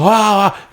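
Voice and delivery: high imitated "ah, ah" of pain; mock pain groans. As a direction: imitate the man's agony, hammed up. Injured man rolling on floor.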